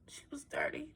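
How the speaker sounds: soft whispered aside. Real, not acted. Storyteller whispering an aside to children. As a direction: hush it to the listeners.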